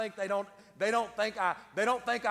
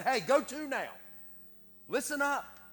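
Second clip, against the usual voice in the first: calling out; 6 semitones higher than usual. Acted. James calling readers to attention.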